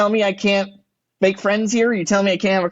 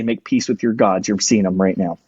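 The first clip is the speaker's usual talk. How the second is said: low menacing line; 10 semitones lower than usual. Retold or imitated. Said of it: "I'd go in that building right now and say".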